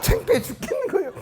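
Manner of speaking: embarrassed, very high voice breaking into laughter; laughing. Real, not acted. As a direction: squeeze the words out through real laughter. 창피한 일화에 웃음이 터짐. (창피해서 웃음 섞인 높은 목소리)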